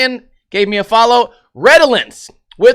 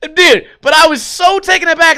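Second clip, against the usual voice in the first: much higher and louder, still laughing it off; excited; 10 semitones higher, 5 dB louder than usual. Real, not acted. Streamer tells listeners how shocked he was, excited. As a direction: blurt it out, amazed.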